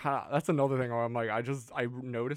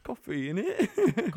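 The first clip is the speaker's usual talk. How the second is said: put-on high, slow English accent; mock English accent, pitched high; 8 semitones higher, 6 dB louder, slower than usual. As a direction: drawl out innit like a Londoner. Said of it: "do the same accent".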